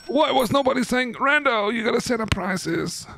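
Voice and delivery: very high-pitched mock wail; mock complaint. Streamer complains that nobody reminded them about prices, high mock wail. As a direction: whine it up high, as if chat should have warned you.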